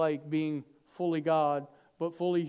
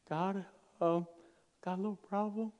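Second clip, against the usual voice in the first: sheepish confession; 7 dB quieter than usual. Pretend.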